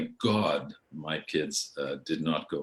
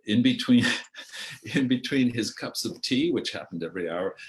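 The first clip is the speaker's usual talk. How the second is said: ordinary delivery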